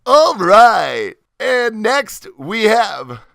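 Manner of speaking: loud, drawn-out ring announcer call; hammed-up announcer. Host bringing on the next guest, hammed-up announcer. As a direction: hold the guest's name long and high. Host's show-opening announcer act.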